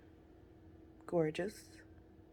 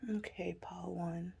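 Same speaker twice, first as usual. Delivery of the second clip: whisper; 7 dB quieter than usual. Real, not acted. Whispered address to pile.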